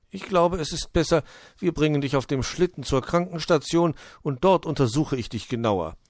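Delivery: leicht angedeuteter alter Uhu, anfangs höher; fürsorglicher Onkel Uhu. Acted. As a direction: rate ruhig wie ein hilfsbereiter Onkel. Voices Uncle Owl as helper. (fürsorglich ratender Uhu)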